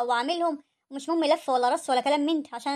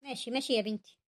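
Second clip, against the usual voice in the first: hurt resignation; 3 semitones lower, 8 dB quieter than usual. Acted. Mother accepts again, hurt.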